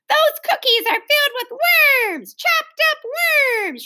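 high, drawn-out gleeful announcement. A narrator's reading. Little girl tells the party about cookies, gleefully. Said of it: "she gleefully told everyone".

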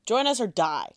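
loud, high threat; threatening. Acted. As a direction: snarl the ultimatum. Intimidating a downed orc.

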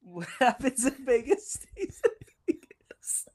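laughing stage whisper; breathy giggling whisper; joking whisper. Acted. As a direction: whisper it like a conspiratorial joke. Joking Vegas motto aside.

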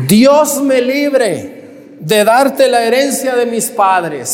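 slow, high, emphatic refusal; Nabot's firm refusal. Acted. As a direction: draw out "Dios me libre" high and slow. Predicador actúa la respuesta de Nabot. (negativa solemne de Nabot)

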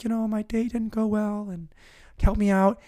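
lightly voiced earnest guy asking for sympathy; solemn guy. Solemn guy asks the station for help, lightly voiced earnest plea. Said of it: "a solemn guy who was just like".

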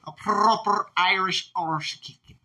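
drawn-out hammed-up Irish accent; slow mock Irish accent. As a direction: savour each word in a fake Irish brogue. Repeating friend's mock Irish line.

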